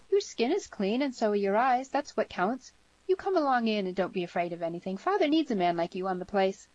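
put-on lively girl reassuring a stranger; girl, encouraging. A narrator's reading. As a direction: urge him along in a light, high voice.

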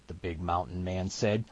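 ordinary delivery, nothing marked

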